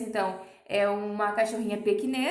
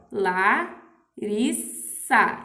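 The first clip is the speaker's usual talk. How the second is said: sílabas separadas devagar; 2 semitones lower, 4 dB louder, much slower than usual. Shown as a demonstration. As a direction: pronounce "Larissa" slowly, syllable by syllable. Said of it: "separei aqui direito na palavra". (falando "Larissa" bem devagar, sílaba por sílaba)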